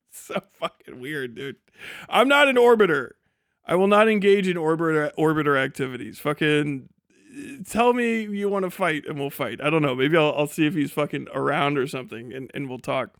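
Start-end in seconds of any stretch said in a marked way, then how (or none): none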